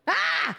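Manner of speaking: short startled cry at a sudden sound; startled yelp. Real, not acted. Startled by a game sound, a sharp yelp. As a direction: jump at the noise and yelp. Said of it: "that was me that made the sound".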